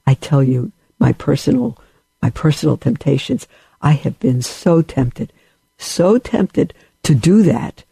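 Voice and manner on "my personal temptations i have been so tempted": usual speaking voice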